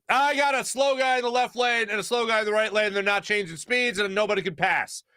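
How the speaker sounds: high, put-on voice reporting in for approval; radio launch request. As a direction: call it in like a mock mission report. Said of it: "you have to be like".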